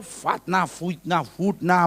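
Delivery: "fitnah" garbled as fatna, fuitna, futna; mocking distortion. Pastor ridiculing the excuse, "fitnah" mockingly distorted. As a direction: mangle "fitnah" with a different vowel each time.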